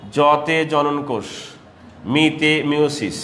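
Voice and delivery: slow, deliberate "জতে জননকোষ, মিতে meiosis"; slow mnemonic. Shown as a demonstration. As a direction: spell out the memory trick slowly. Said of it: "singly উচ্চারণ করবো এভাবে". (ধীরে স্মৃতিসূত্র বলা)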